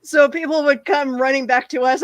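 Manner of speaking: ordinary delivery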